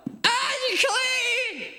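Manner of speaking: high-pitched drawn-out yell; yelled warning. Shown as a demonstration. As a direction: yell it across the whole town. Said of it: "you had to yell".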